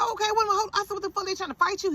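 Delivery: high-pitched, incredulous questioning; retold outraged disbelief; alarmed mother. Acts out alarmed mother questioning son.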